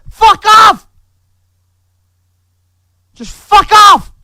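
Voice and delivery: enraged screaming at enemies; furious scream. Real, not acted. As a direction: scream it with all you have. Raging at critics.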